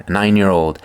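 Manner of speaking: ordinary delivery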